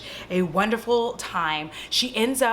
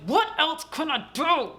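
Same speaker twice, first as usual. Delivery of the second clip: higher, pleading heroine voice; hammed-up desperate appeal; mock desperation; 6 semitones higher than usual. Cursed woman begging Jas for help, mock-desperate plea.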